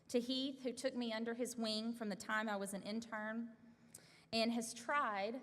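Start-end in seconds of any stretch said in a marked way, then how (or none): 4.3-5.3 "tried" drawn out slowly with emphasis; slow down and punch "tried" pointedly; stress on TRIED. Real, not acted. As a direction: lean hard and long on the word "tried". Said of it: "notice I put the emphasis on tried".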